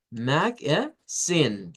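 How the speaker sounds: "magazine" slowly split as mag-a-ZINE; rising final stress. Shown as a demonstration. Teacher shows how to read it, "magazine" split with stressed ending. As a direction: separate the syllables of "magazine" and lift the last. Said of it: "Subimos el volumen".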